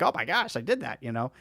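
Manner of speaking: ordinary talk, nothing marked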